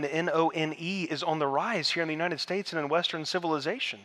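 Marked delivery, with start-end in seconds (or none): 0.0-1.0 "N O N E" spelled as four separate letters, N, O, N, E. Shown as a demonstration. To a church crowd, spelling "N O N E" letter by letter. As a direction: spell "N O N E" out letter by letter so nobody hears "nun". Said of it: "the religious none".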